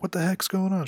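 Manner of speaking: a sudden, panicked question. Retold or imitated. Logan wakes on the ferry, asking in high alarm.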